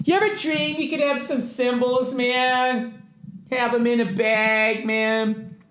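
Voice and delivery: lazy surfer pitch for cymbals; high, soft, hammed-up chill-guy ad; mock stoner ad voice. Shown as a demonstration. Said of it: "start the advertising with a free read".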